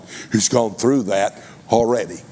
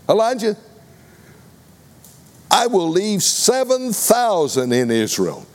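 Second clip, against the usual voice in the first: God's voice; 9 semitones higher than usual. Acted. God answers Elijah.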